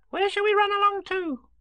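put-on very high, loud child's question; little Roo. A narrator's reading. Roo asks Kanga, little high child voice. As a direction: pipe up in a very high voice.

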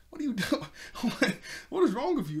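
high-pitched mock outrage, breaking into a laugh; indignant protest. Glimmer refusing to be experimented on, indignant. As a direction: recoil in exaggerated disbelief.

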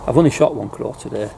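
usual speaking voice

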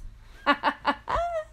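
high, bubbling giggle; short real laugh, pitched high; joyful laugh. Real, not acted. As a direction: burst into delighted high laughter. Bursts out laughing after the yes.

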